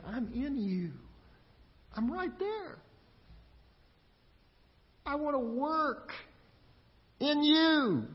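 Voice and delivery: high, tender voice of God; God's reassuring voice. Pretend. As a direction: speak as a loving God inviting.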